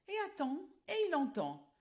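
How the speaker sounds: ordinary delivery, nothing marked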